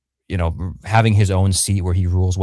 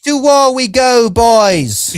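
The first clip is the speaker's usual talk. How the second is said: much higher, louder, slow hammed-up British war cry; rally the boys like a mock pompous prince; hammed-up British prince calling his men to war; low T Damon voice; 14 semitones higher, 5 dB louder, much slower than usual. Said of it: "you do it perfectly, [name]. Low T, low T British man".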